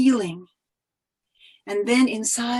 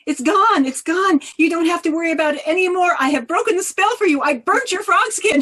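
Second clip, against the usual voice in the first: fast, higher, louder eager reassurance; eager Ivan; 6 semitones higher, 6 dB louder than usual. A narrator's reading. Ivan proudly telling his wife the spell is broken, fast and loud. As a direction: rush out the good news, proud and excited. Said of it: "And he said".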